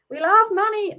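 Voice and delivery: high-pitched, badly faked American accent. Shown as a demonstration. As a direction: have a clumsy go at sounding American. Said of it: "try and do American accent".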